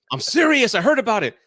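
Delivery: high, loud schoolkid insistence, acted out; imitated friend swearing it's true; insistent protest. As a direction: protest loudly that you mean it. Acts out friend insisting, quarrel retold.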